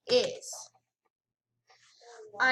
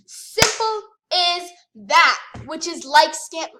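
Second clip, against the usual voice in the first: exasperated rant; 3 semitones higher, 8 dB louder, slower than usual. Real, not acted. Ranting at scammer.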